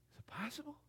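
breathless whispered wonder; awed whisper. Acted. Believer wondering at God's call, in a whisper. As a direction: hush it as if afraid to ask. Voicing awed inner reaction.

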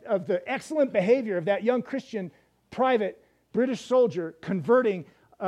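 ordinary talk, nothing marked

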